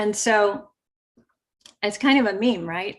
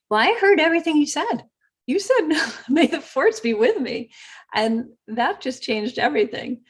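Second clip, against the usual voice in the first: higher, brighter imitation of the agent; lightly voiced happy clerk; cheerful agent; 7 semitones higher than usual. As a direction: mimic her delighted thanks.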